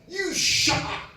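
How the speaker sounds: high, loud, drawn-out order; retold rude shout. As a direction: mimic a loud stranger rudely ordering silence. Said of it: "looks at me, and goes".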